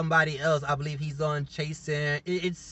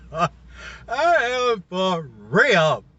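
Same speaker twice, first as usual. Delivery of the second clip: laughing; 4 dB louder, much slower than usual. Real, not acted. Insisting show is wild.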